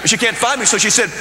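ordinary delivery

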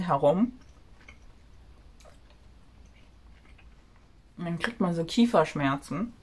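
talking while chewing gum. Real, not acted. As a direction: chew gum between the words. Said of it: "Erstmal kaue ich mal ein bisschen Kaugummi". (mit Kaugummi im Mund)

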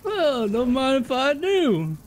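high, drawn-out put-on voice; gleeful mock villain. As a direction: savor it slowly in a high, playful voice. Gleeful put-on line while playing.

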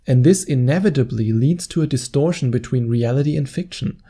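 ordinary delivery

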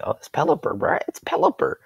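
lightly voiced cocky opponent, pitch jumps high at the end; mock opponent voice. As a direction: tease the rival's smug thought. Mocking opponent's thoughts.

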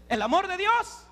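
high, rising question; rousing prompt. Acted. Preacher offers the young women God's love, rousing prompt. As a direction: lift it into a ringing question.

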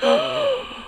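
sharp high movie-style gasp of shock; dramatic gasp. Retold or imitated. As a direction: gasp like the camera just zoomed in on your face. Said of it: "the camera flies in the person's face".

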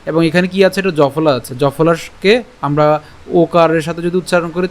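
ordinary delivery

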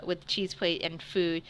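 ordinary delivery

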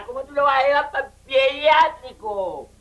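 usual speaking voice

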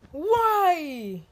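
high, loud whining cry; loud upset wail. Real, not acted. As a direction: wail it out in frustration. Game loss outcry.

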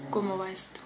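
a soft, breathy whisper to herself; whispered aside. Real, not acted. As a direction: whisper it under your breath while you fiddle with something. Muttered aside while checking the stream.

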